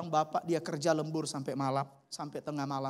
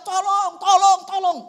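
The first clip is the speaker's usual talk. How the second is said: high, loud cries of help; shouting for help; 12 semitones higher, 11 dB louder, slower than usual. Retold or imitated. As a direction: call out desperately into the dark. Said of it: "Dia teriak-teriak". (teriakan minta tolong yang keras dan tinggi)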